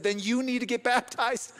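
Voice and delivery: loud, high-pitched, emphatic preaching; full-force preaching. Real, not acted. Preacher telling the congregation Jesus went to the cross for them, full-force preaching. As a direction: proclaim it to the crowd with stretched key words.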